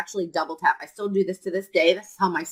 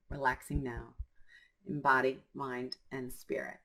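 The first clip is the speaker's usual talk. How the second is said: soft, lowered calming affirmations; relaxing self-talk; 3 semitones lower, 6 dB quieter than usual. Shown as a demonstration. Coach demonstrating tapping to let anger go, relaxing and soothing. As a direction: soothe yourself down, sinking softer and lower. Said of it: "So I'm going to relax now".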